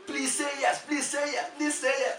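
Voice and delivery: high, urgent chant; imitated crowd cheering her on; screaming crowd. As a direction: chant it like excited onlookers, pitched high. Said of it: "people were screaming".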